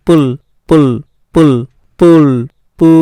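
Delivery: পুল with a u vowel, stretched on the later repeats; u vowel demonstration. Shown as a demonstration. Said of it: "উ আসছে". (পুল-এর উ স্বরটা শেষে টেনে দেখানো)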